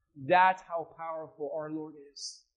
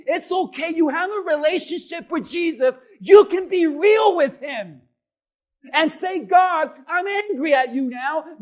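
loud, raised, impassioned pulpit shout; full-force preaching; 8 semitones higher, 13 dB louder than usual. Real, not acted. Preacher urging people to be real with God, shouted preaching. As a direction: cry out the complaints to God at full power.

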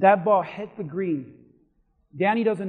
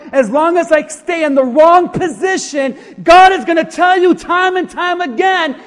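loud, higher preacher's call-and-refrain; preaching at full force; 8 semitones higher, 12 dB louder than usual. Acted. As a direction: hammer "it don't count" home to the church. Preaching climax, repeated refrain.

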